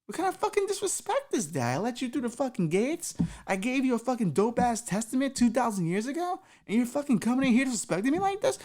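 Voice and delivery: Jesus scolding the guy; Jesus raging. Acted. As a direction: tear into him, outraged. Jesus rants furiously.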